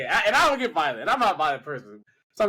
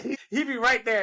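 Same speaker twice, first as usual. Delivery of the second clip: ordinary talk, nothing marked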